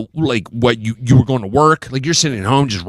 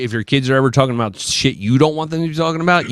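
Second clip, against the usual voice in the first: ordinary talk, nothing marked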